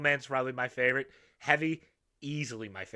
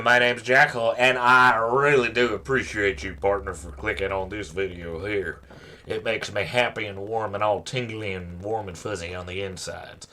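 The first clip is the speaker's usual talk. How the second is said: cowboy persona intro; 5 semitones lower, 5 dB louder than usual. Acted. Channel intro in another persona.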